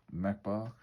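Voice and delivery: plain reading voice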